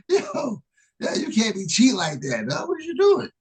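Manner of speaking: high, lightly voiced cry of cheating; half-joking protest; mock accusation. Accusing the friend of cheating, a high half-joking protest. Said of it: "It's like".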